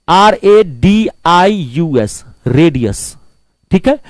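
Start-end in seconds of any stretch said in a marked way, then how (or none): 0.1-2.2 slow, each letter of R A D I U S separate; spelled out letter by letter. Shown as a demonstration. Teacher spelling radius slowly for the class.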